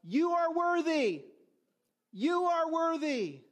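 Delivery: high, slow heralds' cry; yelled herald proclamation. Acted. Heralds hailing the emperor as he arrives, yelled out. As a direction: call it out loud and drawn-out, high-pitched. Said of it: "they would yell out".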